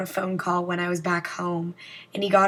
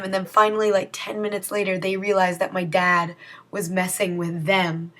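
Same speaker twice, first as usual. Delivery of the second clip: normal talking voice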